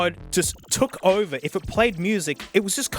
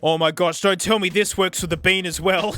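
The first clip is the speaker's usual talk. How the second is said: usual speaking voice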